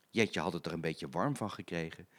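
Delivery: ordinary delivery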